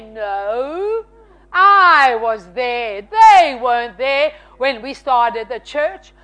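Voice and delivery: slow, indignant rhetorical questions; drawn-out, emphatic outrage; retold anger. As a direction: stretch each challenge out, affronted. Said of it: "because I am so mad".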